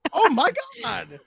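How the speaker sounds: stunned with awe; awed gasp. Retold or imitated. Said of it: "I was like".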